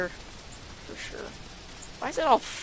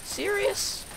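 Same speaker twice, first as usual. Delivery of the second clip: much higher, disbelieving; can't believe it; incredulous; 11 semitones higher, 7 dB quieter than usual. Real, not acted. Incredulous at character.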